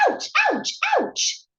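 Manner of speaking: high-pitched storybook yelps; yelling in pain. A narrator's reading. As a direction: yelp each ouch, sharp and high. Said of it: "yells Mister Bumble".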